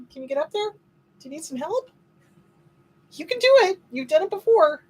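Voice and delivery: sweet high voice urging the dog on; high coaxing pet talk. Real, not acted. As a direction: cheer the dog on, high and bright.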